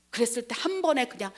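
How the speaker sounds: ordinary talk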